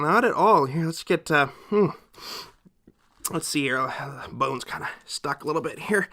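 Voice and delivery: usual speaking voice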